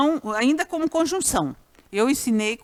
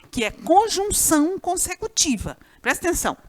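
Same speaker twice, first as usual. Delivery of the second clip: ordinary delivery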